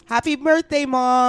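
high, loud birthday call; excited birthday wish. Real, not acted. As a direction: sing it out to Mom. Calling birthday wishes.

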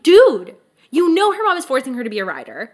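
mock-scold him in exasperation; exasperated scolding. Reader telling off a book character, exasperated.

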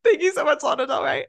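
high imitated fangirl thanks; mock gushing. Host reenacts thanking the singer, gushing. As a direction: gush it as a starstruck fan.

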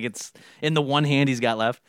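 ordinary delivery

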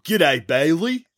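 slow, drawn-out Aussie greeting; Aussie accent. Shown as a demonstration. Host greets a listener on request, Aussie accent. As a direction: put on an Australian lilt, stretched out. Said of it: "heard it in an Aussie accent".

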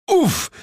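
high, loud oof; man grunting under a heel; winded grunt. Acted. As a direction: grunt as if stepped on. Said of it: "machte der".

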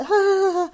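high, drawn-out retold cry; imitated wordless high squeal; high mock "aah". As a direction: let out a high, airy "aah". Acting out panic while keeping calm.

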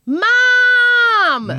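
very high loud yowl; shrill loud cat cry of mum; cat calling mum. Retold or imitated. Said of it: "he was saying".

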